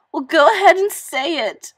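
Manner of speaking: high, loud, furious and close to tears; angry tearful outburst. Acted. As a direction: burst out angry and crying. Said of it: "she burst out in anger and in tears".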